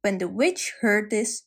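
ordinary delivery

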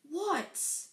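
high, exasperated query. Acted. Harry to Neville tugging his sleeve, exasperated. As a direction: fire it back as one sharp word.

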